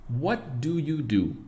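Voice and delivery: slow, clear word-by-word English; careful slow version. Shown as a demonstration. Teacher to students, slow careful English model. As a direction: spell out "What do you do?" slowly, every word separate. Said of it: "E como a gente fala".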